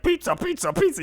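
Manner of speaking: put-on high squeaky chanting kid voice; excited little kid. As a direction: chant pizza like an overexcited four-year-old. Kid excited about pizza.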